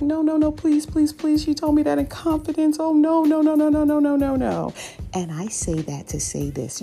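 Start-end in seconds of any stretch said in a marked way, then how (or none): 0.0-4.7 high, pleading string of no's; frantic pleading. Retold or imitated. Coworker pulling Karen back from the elevator, frantic pleading. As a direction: beg her to stop, panicked. Coworker begs her not to confront.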